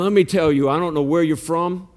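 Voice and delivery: ordinary delivery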